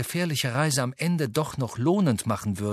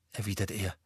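usual speaking voice

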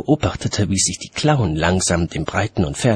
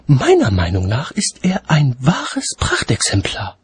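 lightly voiced lady, raised pitch; woman's voice; 6 semitones higher than usual. A narrator's reading. Lady admiring the dragon, lightly voiced higher tone. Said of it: "erklang eine Stimme über ihm".

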